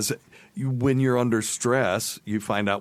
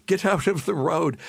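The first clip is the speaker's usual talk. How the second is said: raised-pitch imitation of an irritated driver; impatient bus driver; 8 semitones higher than usual. Bus driver to guests standing in the road, mock-impatient call. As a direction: order people aside as a retold bus driver. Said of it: "honking at us and pointing, like".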